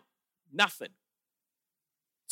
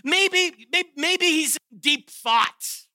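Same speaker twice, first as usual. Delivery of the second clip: higher, slow mocking musing; sarcastic taunt; 6 semitones higher, slower than usual. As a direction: drag it out in mock wonder. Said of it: "Total soaked with sarcasm".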